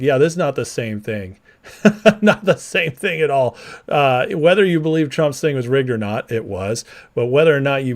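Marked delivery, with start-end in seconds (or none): 1.8-3.5 laughing. Real, not acted. Streamer laughing at a chat comparison. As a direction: scoff through a laugh.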